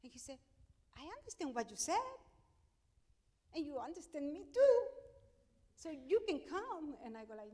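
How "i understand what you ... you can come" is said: high, loud, firm persuading voice; doctor insisting. Retold or imitated. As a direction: talk her into it, loud and sure. Doctor insists she take the job.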